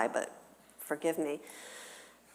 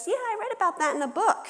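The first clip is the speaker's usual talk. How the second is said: put-on high, quick little-girl chirp; daughter's voice; much faster than usual. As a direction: pipe up fast and high like a young daughter. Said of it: "pipes one of my daughters who goes".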